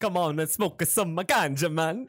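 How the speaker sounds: slow mock Jamaican accent; Jamaican Dracula accent. Boyfriend suggesting a smoke, hammed-up Jamaican accent. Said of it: "Yeah, Jamaican Dracula".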